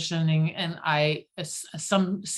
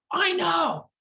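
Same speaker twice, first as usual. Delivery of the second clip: indignant shout; 11 semitones higher, 3 dB louder than usual. Retold or imitated. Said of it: "And I'm like".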